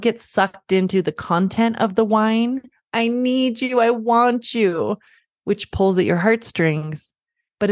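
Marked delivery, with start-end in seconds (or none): 2.9-5.0 mock needy child, higher and drawn out; a light imitation of a whining kid tugging at mom; whiny child. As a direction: plead like a clingy toddler. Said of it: "they're whining".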